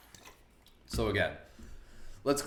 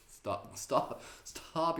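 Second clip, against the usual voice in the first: laughing; 7 semitones higher, much slower than usual. Real, not acted. Laughing, begging to stop.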